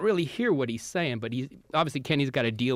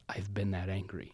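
quiet confession; 7 semitones lower, 9 dB quieter than usual. Retold or imitated. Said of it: "he just goes".

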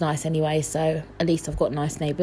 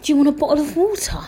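high, quick question; mocking replay of a blurted offer; awkward offer, mocked; 7 semitones higher, faster than usual. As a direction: rush it out in a raised, silly voice. Repeats her awkward offer mockingly.

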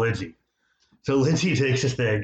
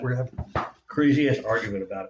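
usual speaking voice

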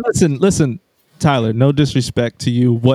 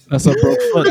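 mock squeal, way up high; high squeaky echo. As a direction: squeak it out for a laugh. Echoes in high voice.

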